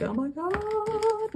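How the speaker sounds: high, slow, drawn-out oh my God; hammed-up imitated dismay; mock exasperation. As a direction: act out a dramatic sigh of despair. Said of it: "you're like".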